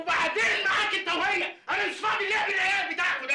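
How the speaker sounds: exasperated shout. Acted. Courier yelling at the bickering pair, exasperated.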